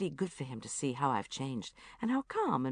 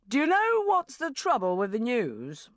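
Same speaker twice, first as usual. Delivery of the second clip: lightly voiced brash boss, a bit higher and louder; a hint of a hyperactive editor ranting; Richard Finch; 6 semitones higher, 5 dB louder than usual. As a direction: boss ranting at his news team.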